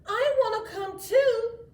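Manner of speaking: high, pleading little-sister voice; little girl voice. A narrator's reading. Little sister begging to join, high and pleading. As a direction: beg to tag along, small and high. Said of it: "said Mariana".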